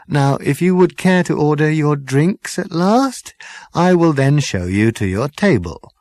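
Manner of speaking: smooth and deferential, serving sir; polite waiter. Acted. Waiter character voice.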